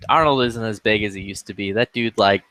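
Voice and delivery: ordinary delivery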